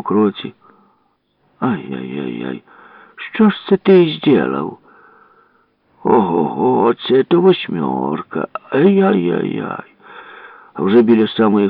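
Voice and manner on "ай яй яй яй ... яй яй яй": slow, drawn-out clucking over a mishap; exaggerated busybody commiseration; mock-sympathetic villagers. Voices villagers clucking over the damage.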